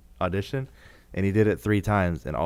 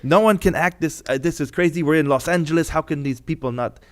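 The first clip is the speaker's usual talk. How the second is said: loud, raised director's rant; retold yelling; 7 semitones higher, 5 dB louder than usual. Director yelling at the casting director in the lobby, loud and raised. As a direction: yell it like a furious director. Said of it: "He was yelling at the casting director".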